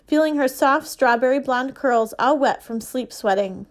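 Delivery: ordinary delivery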